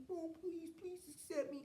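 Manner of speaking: high, soft, whiny begging voice; mock pleading. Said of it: "they try to come back and be".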